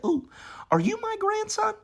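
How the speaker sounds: put-on high, sweet old lady asking; grandma greeting her grandson, high and sweet. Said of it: "She said".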